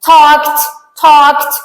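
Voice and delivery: talked said with a crisp t at the end; ending sounds t. Shown as a demonstration. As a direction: clip talked off on a sharp t. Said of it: "ت". (talked با صدای ت در انتها)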